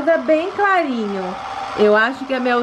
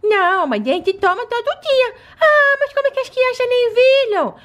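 put-on little girl voice; 9 semitones higher than usual. Doll children chatting.

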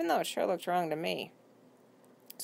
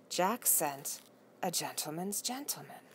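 soft, half to herself; murmured under the breath, much softer; quiet musing; 8 dB quieter, slower than usual. A narrator's reading. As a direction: trail it off in quiet disbelief.

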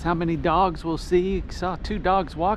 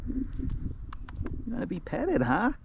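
slow, soft, higher coo to a cat; pet talk; 5 semitones higher, 7 dB quieter, much slower than usual. Real, not acted. Walker cooing to a cat that rolls over to be petted, soft and slow.

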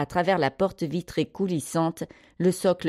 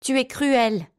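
put-on high-pitched girl's reproach; indignant, higher child's voice; accusing; 8 semitones higher than usual. A narrator's reading. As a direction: accuse her to her face. Girl accuses the nanny.

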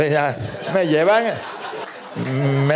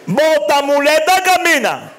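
faith-healer shout; 11 semitones higher than usual. Acted. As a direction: bark the healing orders through the door. Pastor golpea la puerta gritando. (pastor gritando bota muleta)

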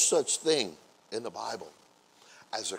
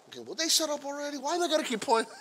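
quick, higher, exasperated mutter; annoyed inner grumble; faster than usual. Retold or imitated. Embarrassed sinner fed up with pointing, quick and exasperated. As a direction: roll your eyes and rush it out. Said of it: "you're like".

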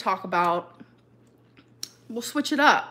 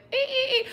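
squeaky ee sounds; 14 semitones higher, faster than usual. Retold or imitated. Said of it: "Twinkie's tail went".

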